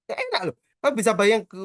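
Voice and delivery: normal talking voice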